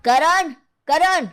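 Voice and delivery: high, drawn-out call; shouting for someone. Acted. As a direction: yell the name across the house. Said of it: "क्यों चिल्ला रहे हो". (ज़ोर से पुकारते हुए)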